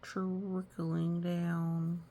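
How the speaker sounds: very slow trickling down; slow trickle. Shown as a demonstration. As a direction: let it trickle slowly. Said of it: "our sad".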